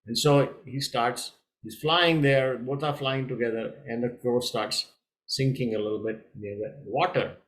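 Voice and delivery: ordinary delivery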